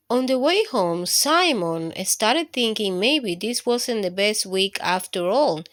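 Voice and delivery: plain reading voice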